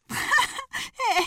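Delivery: high hooting hare laugh; hare's big laugh. Acted. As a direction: burst out laughing. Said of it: "azt se tudta, hová legyen a kacagástól".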